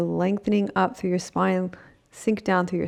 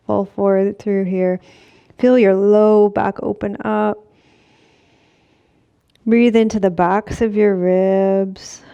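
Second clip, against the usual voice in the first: louder, guiding stretch cues; yoga instructor voice; 2 semitones higher, 7 dB louder than usual. Acted. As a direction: lead the class through the stretch. Cues a forward fold stretch.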